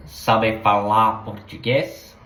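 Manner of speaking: Sabe falar português, slow, each syllable clear; Portuguese, sounded out. Shown as a demonstration. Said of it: "you have to sound that out".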